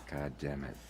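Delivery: usual speaking voice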